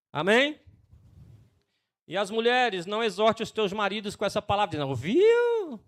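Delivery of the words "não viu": high, slow teasing "não, viu"; playful warning. Acted. Pastor warns wives not to preach at husbands, playful tease. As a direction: tease them with a mock warning.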